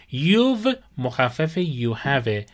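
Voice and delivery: normal talking voice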